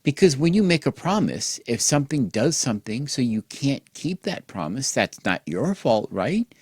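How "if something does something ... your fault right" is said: usual speaking voice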